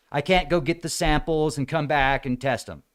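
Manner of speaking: usual speaking voice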